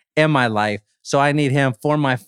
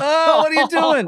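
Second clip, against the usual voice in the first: mock alarm; 14 semitones higher than usual. Mock horror at film scene.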